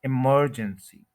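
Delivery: Emergency as i-MER-jen-si, MER stretched; slow emergency with a heavy MER; stress on second syllable. Shown as a demonstration. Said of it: "इसी तरह वो बोलते हैं".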